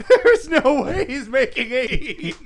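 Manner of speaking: slow, giggling disbelief; laughing. Real, not acted. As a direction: laugh through the words. Laughing at the attempt.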